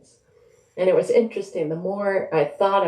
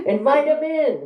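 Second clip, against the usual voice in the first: cheerful husband; 10 semitones higher, 3 dB louder than usual. A narrator's reading. Haru urges wife from study.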